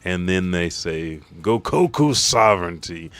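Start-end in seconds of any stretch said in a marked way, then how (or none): 1.4-3.1 loud anime attack call; called attack name. Retold or imitated. Three fighters striking Imu together, loud attack call. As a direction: belt out the technique name.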